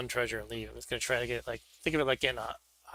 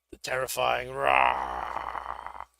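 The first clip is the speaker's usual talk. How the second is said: dramatic announcer voice; 11 semitones lower, 6 dB louder, much slower than usual. Acted. Dramatizing the dragon card.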